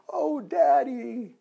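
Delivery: put-on high, drawn-out child's plea; little girl. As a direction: wail it like an upset little daughter. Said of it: "Little Ja-, Janie goes".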